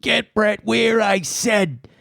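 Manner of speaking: high, insistent demand; famous catchphrase yell. Retold or imitated. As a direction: belt out the catchphrase as a high-pitched order. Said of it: "became so famous from that line".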